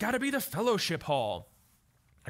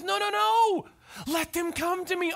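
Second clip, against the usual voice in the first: Jesus insisting; 10 semitones higher, 4 dB louder than usual. Retold or imitated. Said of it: "and Jesus goes".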